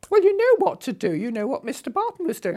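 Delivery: loud, high, exasperated boss; retelling the boss's loud, high-pitched insistence; indignant boss. Retold or imitated. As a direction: bark it back at her like an irritated boss. Boss angrily insisting on demands.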